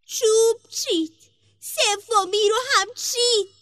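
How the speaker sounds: high, loud tattling tree voice; magic tree. A narrator's reading. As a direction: call out the theft in a high character voice. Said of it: "درخت جادو". (صدای بلند و زیرِ درخت جادو)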